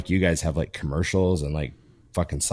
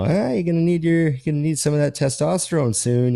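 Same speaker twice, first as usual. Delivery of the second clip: high, teasing needle at his brother; mock teasing; 9 semitones higher than usual. Teasing the doctor brother about testosterone, mock higher voice. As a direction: rib him in a put-on higher voice. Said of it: "I'm always bugging him".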